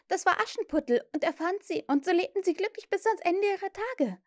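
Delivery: put-on high, eager girl's rush; excited. A narrator's reading. Wendy tells Peter how Cinderella ends, excited. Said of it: "sagte Wendy aufgeregt".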